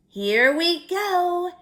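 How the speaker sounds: ordinary delivery, nothing marked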